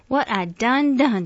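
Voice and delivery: despairing, impassioned appeal; anguished cry. A narrator's reading. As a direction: cry out slowly in grief. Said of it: "she cried".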